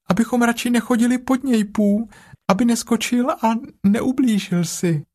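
nervous Piglet. A narrator's reading. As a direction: rush it out in a small fright. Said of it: "Piglet speaking". (vysoký rychlý ustrašený hlásek prasátka)

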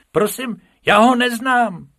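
very high, loud pupil piping up; high childlike protest; Bobek. A narrator's reading. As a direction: squeak up in class as a little rabbit. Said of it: "ozval se Bobek".

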